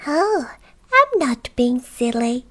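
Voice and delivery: high-pitched goblin with a secret; little goblin voice. Acted. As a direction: tell it in a high creature voice. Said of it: "a little voice".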